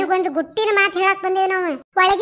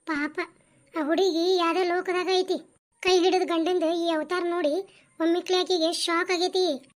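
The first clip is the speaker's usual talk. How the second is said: sympathetic narration; 4 dB quieter than usual. A narrator's reading. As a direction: lament her fate gently. Narrator comments on scene. (ಮೃದುವಾದ ಕಥನ)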